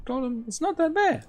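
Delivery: very high-pitched protest; mock reassurance. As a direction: insist in a small, squeaky-high voice. Quotes own reassurance about ping.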